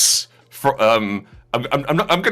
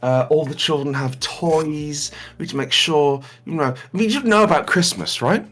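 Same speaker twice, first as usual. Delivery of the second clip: nice lady official; 3 semitones higher, 3 dB quieter than usual. Acted. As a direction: speak kindly and gently. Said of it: "she just sort of has a normal, nice voice".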